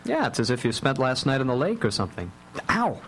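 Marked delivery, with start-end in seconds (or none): 2.7-3.0 sudden high ow; pained yelp. Acted. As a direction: yelp at a kick on the toe. Kicked under the table.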